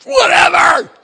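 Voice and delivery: high, loud mock yell; angry teen outburst. Son to dad before the door slams, angry yell. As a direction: hurl it out in full fury.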